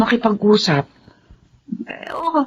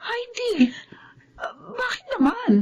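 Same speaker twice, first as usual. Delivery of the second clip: breathy whisper, close to tears; hushed, choked whisper; tearful whisper; 11 semitones higher, 8 dB quieter than usual. Acted. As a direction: whisper it as the truth dawns. Mother realizes, whispering in tears.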